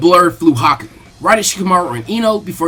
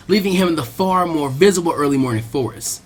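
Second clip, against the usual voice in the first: ordinary delivery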